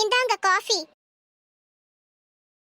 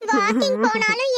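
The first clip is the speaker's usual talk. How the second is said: usual speaking voice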